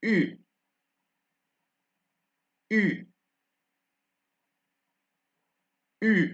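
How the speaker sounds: U as rounded "ee", high pitched; French U sound. Shown as a demonstration. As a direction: say each U with lips rounded for "ee". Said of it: "sound that does not exist in English".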